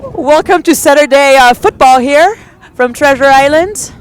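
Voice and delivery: big booming announcer intro; hammed-up stadium announcer. Announcer intro done in jest.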